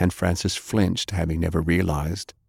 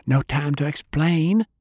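put-on higher, quick girl's voice; hurried Fay; 8 semitones higher than usual. A narrator's reading. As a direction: brush it off in a rush. Fay in a hurry.

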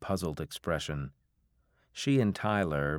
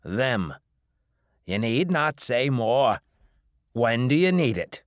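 hard New England drawl; 6 semitones higher, 7 dB louder than usual. A narrator's reading. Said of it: "his face going hard".

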